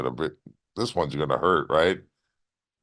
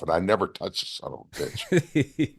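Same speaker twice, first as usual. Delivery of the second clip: softer, chuckling through the words; laughing; 5 dB quieter than usual. Real, not acted. Wrestler to the host about the missed clothesline, laughing softly. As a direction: laugh as you admit it.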